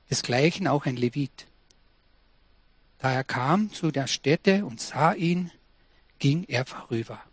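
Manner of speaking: calm, measured reading of the Bible passage; scripture reading. Acted. Preacher reading the Good Samaritan to the congregation, solemn scripture reading. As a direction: read the parable solemnly from the pulpit. Pastor reads Luke 10 aloud.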